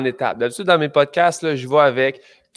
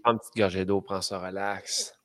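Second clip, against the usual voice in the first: reassuring; 6 semitones lower, 8 dB quieter than usual. Real, not acted. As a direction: gently tell her to relax. Host reassures guest.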